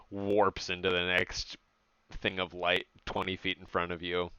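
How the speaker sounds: ordinary talk, nothing marked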